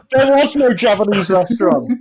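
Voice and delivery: giggling through the line; words breaking into laughter; laughing. Real, not acted. Group laughing at the story.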